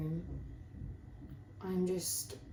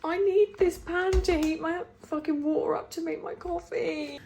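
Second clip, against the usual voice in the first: loud, higher frustrated complaint; angry rant; 10 semitones higher, 9 dB louder than usual. Real, not acted. Vlogger ranting at the dirty pan, loud frustration.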